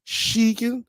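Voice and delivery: sheekin said high with a rising lilt; granddad's sheekin. Retold or imitated. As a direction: lift "Sheekin" up like an old granddad calling. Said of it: "He'd say".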